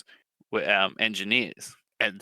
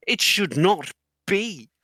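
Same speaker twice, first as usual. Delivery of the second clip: high, loud protest; exasperated; 8 semitones higher, 5 dB louder than usual. Retold or imitated. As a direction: hit each word in disbelief at such stupidity. Exasperated reaction to the video.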